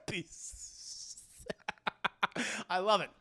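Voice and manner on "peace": whispered Palpatine. Retold or imitated. Repeats Palpatine's last word.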